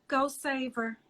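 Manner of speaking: soft, hushed "Go save her"; mom's gentle urging. Retold or imitated. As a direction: quietly nudge the older sister to go help. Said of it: "She would always go to the other one and say".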